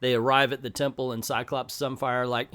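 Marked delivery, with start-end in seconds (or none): none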